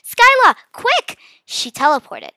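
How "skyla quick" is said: very high, urgent cry for help; urgent call. A narrator's reading. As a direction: call out to hurry a friend. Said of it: "my sister calls out".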